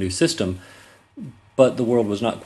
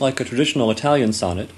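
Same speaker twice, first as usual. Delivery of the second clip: ordinary delivery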